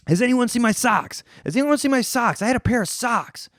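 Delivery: desperate hungover hunt for socks; frantic search. Retold or imitated.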